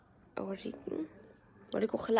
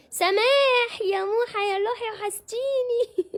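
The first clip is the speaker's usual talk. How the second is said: high, slow, gushing welcome; gushing greeting; 10 semitones higher, 4 dB louder, slower than usual. Real, not acted. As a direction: coo over her like a dear friend. Greeting a fan fondly on live. (ترحيب متحمس بصوت عالي وبطيء)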